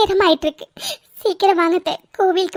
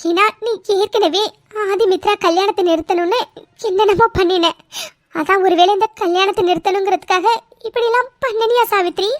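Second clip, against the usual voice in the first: usual speaking voice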